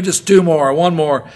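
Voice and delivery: loud urging count; spotter yelling. Retold or imitated. Spotter urging the lifter on, loud shouting. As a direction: push him through the last reps.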